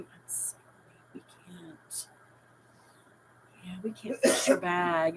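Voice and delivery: hushed, mostly whispered apology; discreet refusal. Acted. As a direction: whisper it like discreet store staff. Imagined store staff refusing discreetly.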